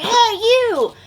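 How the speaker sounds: high mock kid shout; kid calling out. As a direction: call it out cocky. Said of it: "he's like".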